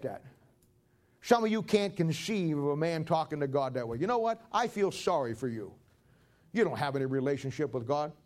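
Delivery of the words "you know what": ordinary talk, nothing marked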